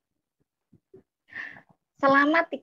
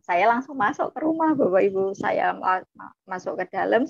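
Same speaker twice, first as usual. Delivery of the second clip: ordinary talk, nothing marked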